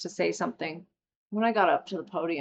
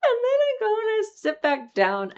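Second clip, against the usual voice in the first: laughing crying; 7 dB louder than usual. Real, not acted. Laughing, voice cracking up.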